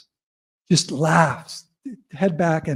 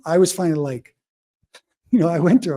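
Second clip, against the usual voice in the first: ordinary talk, nothing marked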